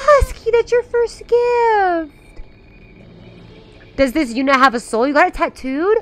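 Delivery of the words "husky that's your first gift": high, slow, drawn-out cooing; softened high gushing; touched. Real, not acted. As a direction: coo it slowly, moved by the gift. Repeats it, touched by gift.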